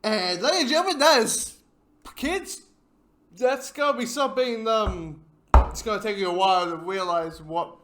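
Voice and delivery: high, loud showman's address; mock announcement. Viewer addressing ladies, gentlemen and kids, loud announcing. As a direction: call it out high and loud to the crowd.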